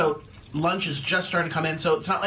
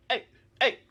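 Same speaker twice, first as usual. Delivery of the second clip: ordinary delivery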